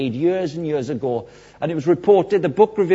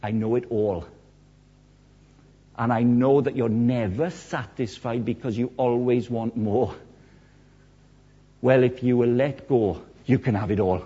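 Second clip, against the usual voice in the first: soft, lowered, gentle voice; kindly; 4 semitones lower, 4 dB quieter than usual. Retold or imitated. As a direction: reassure tenderly, dropping the volume. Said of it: "Jesus very kindly says".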